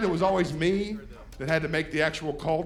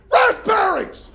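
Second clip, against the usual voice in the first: excited exclamation; 11 semitones higher, 6 dB louder, slower than usual. Acted. Punchline exclaimed.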